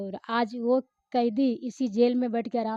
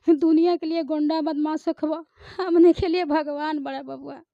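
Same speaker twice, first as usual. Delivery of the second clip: louder, higher dramatic appeal; film dialogue performance; 5 semitones higher, 7 dB louder than usual. Shown as a demonstration. Actress as a mother confronting the gangster, raised dramatic voice. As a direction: declaim it like a wronged mother on screen. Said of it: "उसका जरा एक dialogue बोलिए".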